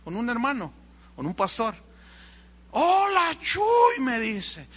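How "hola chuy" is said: hello high and loud, over-friendly; saludo efusivo. Retold or imitated. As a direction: greet him like an old pal, loudly. Saludo fingido del pastor vendido. (saludo exagerado y fuerte)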